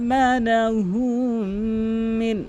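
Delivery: slow, drawn-out chanted recitation; Quran recitation. Shown as a demonstration. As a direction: recite with long stretched vowels. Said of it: "এক আলিফ টেনে". (ধীর টেনে টেনে কুরআন তিলাওয়াত)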